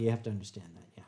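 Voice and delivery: hushed whisper; whispered aside. Real, not acted. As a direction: drop to a whisper.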